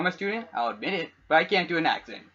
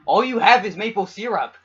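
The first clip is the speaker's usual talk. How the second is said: scolding; 5 semitones higher, 7 dB louder than usual. Retold or imitated. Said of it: "And I'm like".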